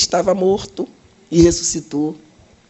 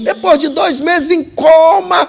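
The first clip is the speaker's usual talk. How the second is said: loud, high, slow shouted news; excited shout; 9 semitones higher, 6 dB louder than usual. Retold or imitated. Doctor announcing the recovery to the director, shouting. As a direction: shout the miracle out.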